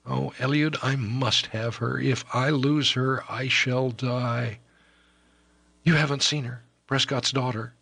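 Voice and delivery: put-on desperate young lover. Young Ellis begs his foster brother for help, desperate and pleading. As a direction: plead as if your life hangs on it.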